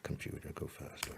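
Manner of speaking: breathy whisper begging the machine; exasperated whisper at the laptop; whispered plea. Real, not acted. Whispered plea to slow computer.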